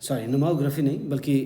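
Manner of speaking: normal talking voice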